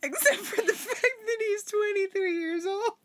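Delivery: high, breaking into laughter; laughing. Real, not acted. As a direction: reread it cracking up laughing. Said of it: "rereads the line laughing".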